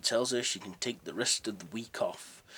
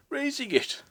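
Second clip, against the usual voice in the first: much higher laughing disbelief; stunned laugh; 7 dB louder than usual. Acted. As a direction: laugh out the question in shock. Cratchit stunned by raise.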